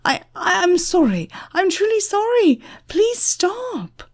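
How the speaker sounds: very high, desperate begging; panicked, high-pitched apology; yelled pleading. A narrator's reading. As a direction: beg for mercy in a high, pained voice. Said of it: "Christopher yelled".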